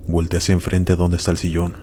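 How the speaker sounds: plain reading voice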